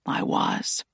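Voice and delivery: soft rasping whisper; hushed old man's whisper; whispering Aslan. Acted. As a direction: breathe it, quietly conceding.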